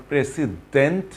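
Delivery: "প্রেসিডেন্ট" split up with s and a full "dent"; wrong pronunciation. Shown as a demonstration. Teacher shows what not to say, mispronunciation. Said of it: "না বলে".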